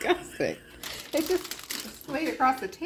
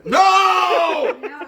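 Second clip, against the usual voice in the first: laughing shout; 4 semitones higher, 12 dB louder than usual. Real, not acted. Laughing while feeding him.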